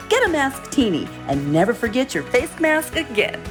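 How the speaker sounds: ordinary talk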